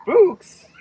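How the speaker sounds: high, loud startled gasp of a word. Acted. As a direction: gasp it out in alarm. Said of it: "gasped the scouts".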